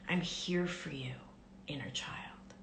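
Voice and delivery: soft whispered reassurance, fading; tender whisper. Shown as a demonstration. Speaking to the inner child, tender whisper. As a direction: hush it lovingly to a child. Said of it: "you can even start by saying".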